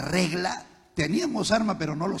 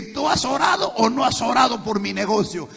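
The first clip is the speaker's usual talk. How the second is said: angry demand; 7 semitones higher, 5 dB louder than usual. Retold or imitated. Husband confronts wife shouting.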